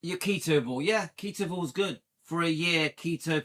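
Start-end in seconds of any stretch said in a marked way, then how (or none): none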